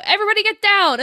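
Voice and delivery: imitated warning call; mock shouted order. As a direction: call it out like a raiding guard. Guards storm in shouting an order.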